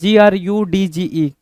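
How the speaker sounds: slow letter-by-letter spelling; dictating letters; spelled out. Shown as a demonstration. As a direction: spell each letter slowly. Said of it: "ग्रज".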